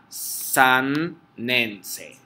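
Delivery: "san nense" said slowly, each syllable clear; slow teacher demo; slow model phrase. Shown as a demonstration. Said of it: "tiene que agregar nense".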